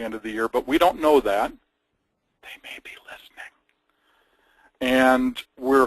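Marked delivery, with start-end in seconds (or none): 2.4-3.5 hushed conspiratorial whisper. Real, not acted. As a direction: whisper it like a secret. Conspiratorial aside.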